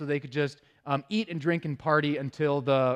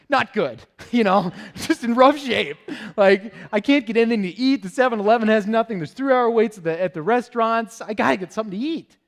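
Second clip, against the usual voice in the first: desperate plea; 6 semitones higher, 6 dB louder than usual. Retold or imitated. Pleading with the man, laughing.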